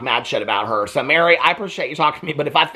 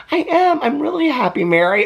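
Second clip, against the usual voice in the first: much higher, mock-delighted Janelle; Janelle, chirpy; 12 semitones higher than usual. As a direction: play it sugary and pleased. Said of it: "And she's like".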